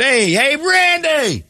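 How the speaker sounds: high-pitched crowd holler; acted fan yell, pitch way up; calling out. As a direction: shout to a celebrity across the course. Demonstrates hollering at a celebrity.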